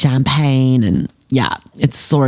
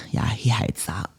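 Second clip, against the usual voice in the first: soft unvoiced whisper, much softer; whispered aside; 3 semitones lower, 10 dB quieter than usual. Smug aside to viewers about ignoring Dad, whispered. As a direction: breathe it as a sneaky aside.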